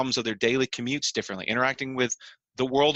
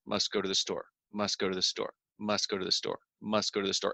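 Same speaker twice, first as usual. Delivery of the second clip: low, soft muttered mantra, over and over; a distracted man muttering his errand under his breath; muttering to himself; 6 semitones lower, 6 dB quieter than usual. Imitating a passerby who ignores a crying girl, low soft mutter. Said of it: "he's muttering to himself".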